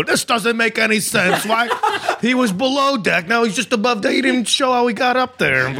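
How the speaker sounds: high-pitched mock outrage; indignant grown man. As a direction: whine in baffled protest at a plot hole. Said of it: "a grown man, go".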